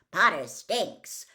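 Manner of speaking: loud, slow sneering jeer; jeering taunt. Acted. Slytherin students taunting Harry, loud slow jeer. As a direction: taunt him as he passes.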